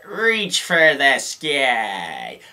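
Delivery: very slow, drawn-out toy voice; slow, eerie talking-toy drawl; Woody pull-string line. Retold or imitated. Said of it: "he's like".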